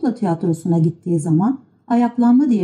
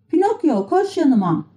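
higher-pitched summons; calling out; 8 semitones higher than usual. A narrator's reading. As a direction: call a friend over. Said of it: "diye seslendi". (tiz sesle çağırış)

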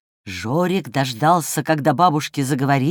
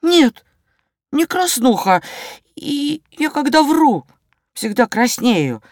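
высокий детский голос, с запинками; признаваться по-мальчишески, высоко, с паузами; честное признание мальчика; 8 semitones higher than usual. A narrator's reading. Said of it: "честно признался Жорик". (честно, по-детски высоко)

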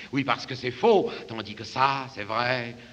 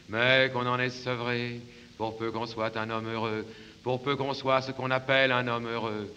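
ordinary talk, nothing marked

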